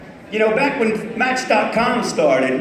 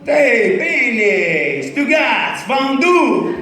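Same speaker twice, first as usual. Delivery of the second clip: hammed-up Italian accent, a bit higher; mock Italian; 3 semitones higher, much slower than usual. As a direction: play a cartoon Italian uncle. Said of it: "It could be Italian".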